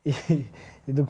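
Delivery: ordinary talk, nothing marked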